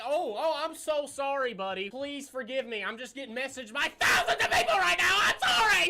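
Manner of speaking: high, loud mock pleading building to a scream; hammed-up apology that erupts into yelling; sarcastic apology.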